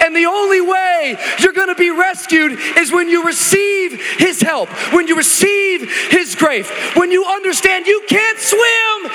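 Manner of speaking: high, loud, building sermon shout; preaching at full force. Real, not acted. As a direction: preach it out to the congregation.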